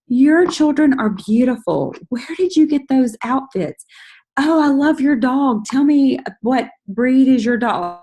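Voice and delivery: put-on high, slow, bubbly gushing over their kids and dog; gushing friendly message. Shown as a demonstration. Networker demoing a warm message to an old friend, high and gushing. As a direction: sweet-talk an old friend with delight.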